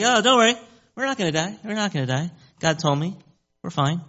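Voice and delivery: high, easygoing, waving the worry away; super calm. Acted. Paul telling shipmates nobody will die, super calm. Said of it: "he's kinda super calm about this".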